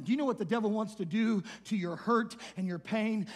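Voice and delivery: normal talking voice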